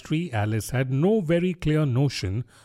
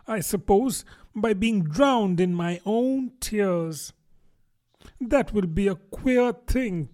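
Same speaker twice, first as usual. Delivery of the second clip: higher-pitched, musing Alice voice; higher storybook voice for Alice; fretful young Alice; 7 semitones higher than usual. A narrator's reading. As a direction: read Alice's worries in a lifted character voice. Reads Alice's speech in character.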